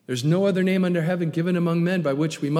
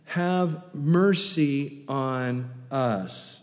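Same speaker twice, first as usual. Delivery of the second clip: very slow, each word drawn out; retold cry for mercy; 2 semitones lower, much slower than usual. Blind men asking for healing, slow deliberate plea. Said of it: "they cried out saying".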